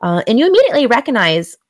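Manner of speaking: normal talking voice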